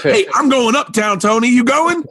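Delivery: high-pitched, animated imitation; Tracy Morgan calling out. Tracy Morgan hailing Tony as he leaves, imitated calling out.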